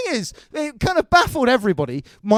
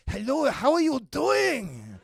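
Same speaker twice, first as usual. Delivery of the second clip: slow, stretched fake Swedish; botched Swedish accent; slower than usual. Batman as a Swede, botched Swedish accent. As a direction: drag it out in a bad Swedish accent. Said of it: "that's not a Swedish accent".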